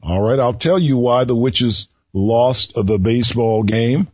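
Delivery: ordinary talk, nothing marked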